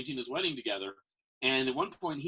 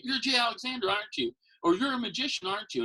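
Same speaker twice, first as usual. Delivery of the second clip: higher, coaxing fan voice; a fan fishing to be right; eager fan; 9 semitones higher than usual. Retold or imitated. Fan stops a magician in public, higher eager voice.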